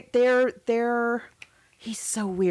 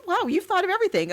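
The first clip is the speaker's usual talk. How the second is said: much higher and fast, wide-eyed; high naive awe; 10 semitones higher, faster than usual. Retold or imitated. Imitating Rosemary answering her husband, high and wide-eyed. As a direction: gush like a naive wife.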